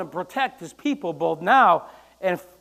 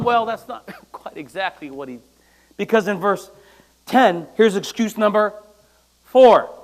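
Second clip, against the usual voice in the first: ordinary delivery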